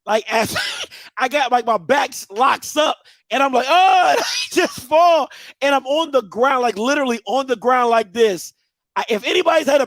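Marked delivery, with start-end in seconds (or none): 3.7-4.1 sudden loud, high "oh" of pain; acted-out yelp of pain; pained cry. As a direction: cry out as your back locks. Acts out back locking up.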